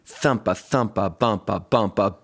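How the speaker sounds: ordinary delivery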